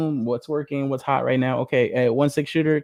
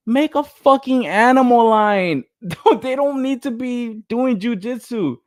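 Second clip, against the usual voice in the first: exasperated rant; 11 semitones higher, 4 dB louder than usual. Real, not acted. Ranting at toy company.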